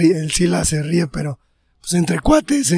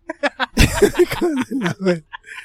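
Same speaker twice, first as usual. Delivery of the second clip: high burst of laughter; 6 semitones higher than usual. Real, not acted. Laughs mid-answer at himself.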